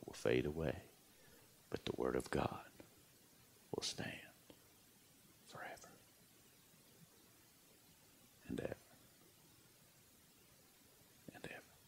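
whispered, trailing off to nothing; fading whisper. Acted. Preacher ending the sermon to his listeners, fading whisper. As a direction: let it die away softly.

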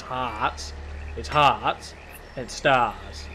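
'hearts' and 'stars' drawn out with no R; stretched-out Australian vowels. Shown as a demonstration. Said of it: "'Cause we do stretch 'em out".

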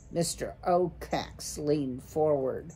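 plain reading voice

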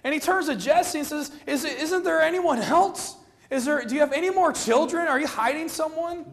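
a prophet losing patience, voiced by the preacher; frustrated Samuel. Preacher voicing Samuel pleading with Jesse, mildly exasperated. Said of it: "I'm sure Samuel's frustrated".

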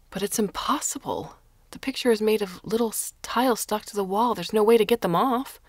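indignant, building to a loud protest; rising protest. A narrator's reading. Carlo protests the theft.

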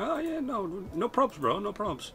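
airy high "no probs, bro"; high casual brush-off. Retold or imitated. To his brain, a high breezy brush-off. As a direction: wave off the worry lightly.

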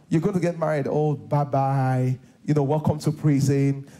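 normal talking voice

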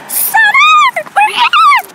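very high, loud excited call; excited shout. Real, not acted. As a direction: shriek it out with joy. Arriving at party, calling out.

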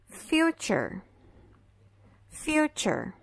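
future with ch sound; fyoo-cher, t as ch; future, slow English model; English model word. Shown as a demonstration. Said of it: "esse T vai ser pronunciado como C H".

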